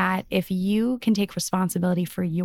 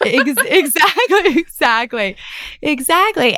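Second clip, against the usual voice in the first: loud, higher laughing exactly; laughing agreement; 8 semitones higher, 7 dB louder than usual. Real, not acted. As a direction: burst out laughing as you agree. Laughing hard agreement with partner.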